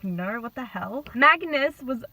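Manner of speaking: ordinary talk, nothing marked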